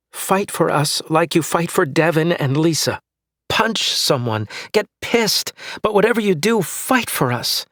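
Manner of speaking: wife begging husband to fight for marriage, rising to a cry; impassioned plea. Acted. Wife pleading, building to shout.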